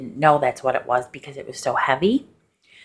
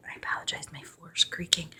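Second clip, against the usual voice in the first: whispered aside; 3 semitones higher, 12 dB quieter than usual. Real, not acted. Aside about creaking floor.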